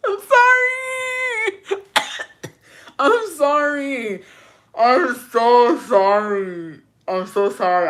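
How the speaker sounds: high, drawn-out wail through laughter; laughing apology. Real, not acted. Host apologizing to letter writer, helplessly laughing. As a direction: squeal the words out slowly while cracking up. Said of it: "I should not be laughing".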